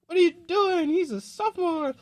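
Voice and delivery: put-on high, loud scolding girl voice; shocked friends. Friends pulling her off him, shrill alarm.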